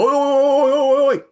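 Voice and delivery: very high, loud Australian yell; frantic mock-Australian shouting; Aussie warning yell. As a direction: holler to stop someone walking into your car. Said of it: "They don't just yell oi".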